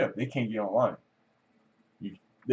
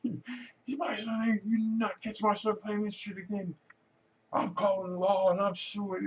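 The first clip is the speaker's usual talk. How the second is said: outraged parent act; 11 semitones higher than usual. Retold or imitated. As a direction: put on a high, indignant parent's protest. Acting out another outraged parent.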